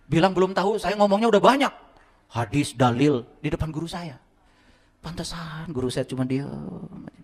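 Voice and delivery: usual speaking voice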